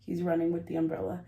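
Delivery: usual speaking voice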